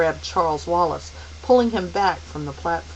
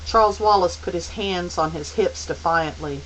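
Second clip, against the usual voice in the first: ordinary delivery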